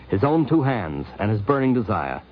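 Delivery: ordinary delivery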